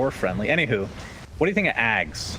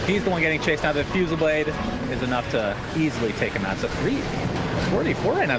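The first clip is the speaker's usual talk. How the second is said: higher-pitched esports chase call; live play-by-play; 5 semitones higher than usual. Real, not acted. Caster telling viewers who is being chased, higher-pitched play-by-play. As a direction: keep calling the kills as they land.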